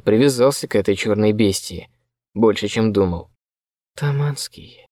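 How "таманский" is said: soft whisper into an ear. A narrator's reading. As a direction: whisper to wake him gently. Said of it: "зашептал я Косте на ухо". (шёпотом на ухо)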